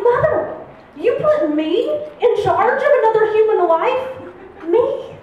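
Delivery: put-on livid teen. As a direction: teenager furious at her mother. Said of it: "I was like".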